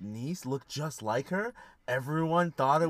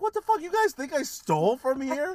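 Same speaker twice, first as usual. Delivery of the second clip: high, loud mock outrage; indignant outburst; 13 semitones higher, 5 dB louder than usual. Retelling his call to a work buddy, indignant. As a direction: act out being wrongly accused, voice shooting up. Retells reaction to being suspected.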